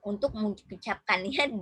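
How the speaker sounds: ordinary talk, nothing marked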